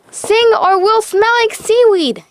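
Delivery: high, loud, grossed-out cry; disgusted yell. A narrator's reading. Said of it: "yelled Disgust".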